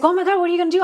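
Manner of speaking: high, loud astonished reaction; excited brother. Retold or imitated. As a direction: reenact a thrilled sibling's question. Brother reacting to big news.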